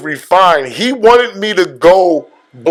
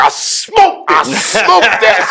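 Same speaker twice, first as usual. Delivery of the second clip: high, laughing hype; hyped and laughing through it; excited laughing quote; 5 semitones higher, slower than usual. Retold or imitated. Rappelling friend's hype reaction.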